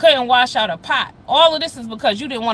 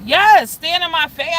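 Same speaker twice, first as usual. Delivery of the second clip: slow indignant challenge, louder and higher; angry, drawn out; 6 semitones higher, 5 dB louder, slower than usual. Real, not acted. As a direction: dare him to stand there, slowly and louder. Angry retelling of confrontation.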